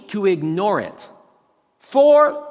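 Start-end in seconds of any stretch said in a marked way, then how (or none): 1.8-2.5 high, loud golfer's shout; acted golf warning yell. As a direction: shout it out across the course. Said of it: "you hear somebody yell".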